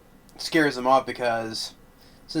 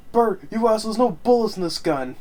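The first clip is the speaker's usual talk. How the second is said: much higher, indignant imitated yell; mock angry shout; 8 semitones higher than usual. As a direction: shout at Bert like a betrayed buddy. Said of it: "Melvin's like".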